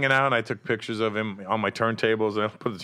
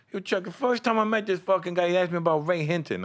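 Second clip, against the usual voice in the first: Mike Tyson impression; 8 semitones higher than usual. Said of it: "He goes".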